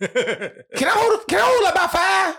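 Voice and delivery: high, slow pleading "can I hold" breaking into laughter; mock begging. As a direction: beg for five bucks with a laugh rising high. Acted begging a friend for money.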